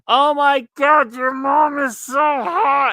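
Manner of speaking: drawn-out swooning "so hot"; mock gushing guys. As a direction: gush like a dazzled teenage boy. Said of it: "everyone would go".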